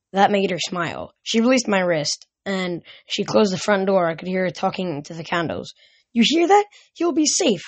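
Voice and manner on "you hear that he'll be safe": high, eager burst; excited. Acted. Mother telling her candles her son is safe, high and excited.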